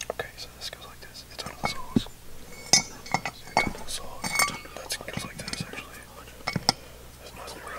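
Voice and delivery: barely audible unvoiced whisper; whispering to himself. Real, not acted. Puzzling out the parts alone, whispering. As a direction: whisper it privately, very softly.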